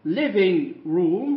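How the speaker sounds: living room drawn out, stress on living; slow, clear teacher model; slow modelling. Shown as a demonstration. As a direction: spell the sound out slowly for learners. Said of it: "حجرة المعيشة".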